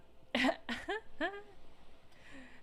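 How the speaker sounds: high, trailing laugh. Real, not acted. Player amused by the game, high falling laugh. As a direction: let out a high laugh that falls away.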